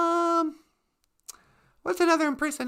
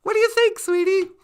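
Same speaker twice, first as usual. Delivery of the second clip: usual speaking voice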